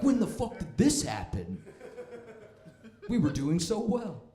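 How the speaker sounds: slow, high-pitched mock dismay. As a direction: wail it in hammed-up disbelief. Old racist waking to shocking news.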